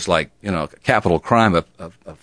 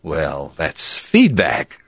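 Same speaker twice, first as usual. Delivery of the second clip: ordinary talk, nothing marked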